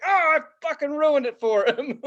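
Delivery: high wail of regret; mock anguish. Follower watches the comic leave, high mock-anguished regret. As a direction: lament it with comic despair. Retold dismay at spoiling comic's day.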